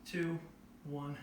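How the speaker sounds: strained, breathing through the hold; holding a wall sit. Real, not acted. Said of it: "Try to control your breathing here".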